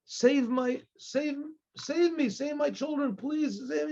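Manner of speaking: acted father's mounting, imploring cry to God; desperate plea. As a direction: beg heaven for help, voice rising toward tears. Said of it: "And he starts crying".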